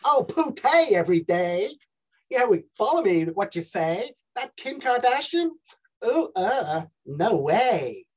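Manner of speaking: high-pitched Californian influencer voice; Californian accent. Acted. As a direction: gush like a Californian vlogger. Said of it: "Your accent is Californian".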